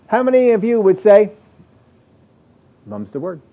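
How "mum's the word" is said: soft, lower voice; acted hush, much softer; hushed promise. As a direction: play someone agreeing to keep a secret. Acting someone agreeing to keep silent.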